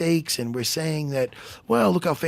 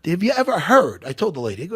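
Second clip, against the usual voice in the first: ordinary delivery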